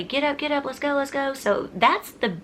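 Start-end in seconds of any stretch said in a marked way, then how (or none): 0.0-1.3 bossy daughter. Retold or imitated. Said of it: "telling everybody".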